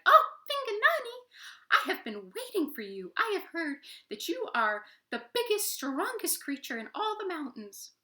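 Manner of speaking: very high sweet squeaky flattery; butter up the giant in a squeak; flattering tiny toad. Acted. Tree Toad flattering Vinganani, high sweet squeaky voice.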